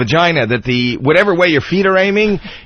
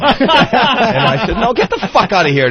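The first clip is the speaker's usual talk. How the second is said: laughing dismissal; 7 semitones higher than usual. Real, not acted. Laughing, brushing off driver.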